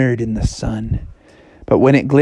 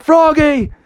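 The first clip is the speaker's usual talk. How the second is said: high relieved call; 11 semitones higher than usual. Acted. Father calls out.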